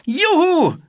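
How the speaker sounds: loud, very high cheer; joyful whoop. A narrator's reading. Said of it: "rief er".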